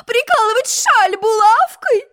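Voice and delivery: very high, slow crying whine; hammed-up whining queen. A narrator's reading. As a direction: whinge it out like a child. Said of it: "заныла она".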